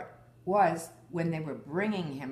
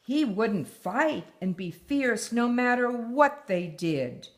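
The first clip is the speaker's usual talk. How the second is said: put-on reading a picture book aloud to her child; story reading; 5 semitones higher, 7 dB louder than usual. A narrator's reading. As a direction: tell the tale gently, like a bedtime story. Mother reads story aloud.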